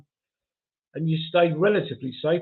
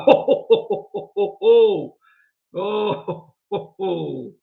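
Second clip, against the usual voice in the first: real laughter; 2 semitones higher, 5 dB louder, much slower than usual. Real, not acted. Host reacting to Kevin the Carrot joke, laughing out loud. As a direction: burst out in rolling oh-ho-ho laughter. Cracking up at viewer's comment.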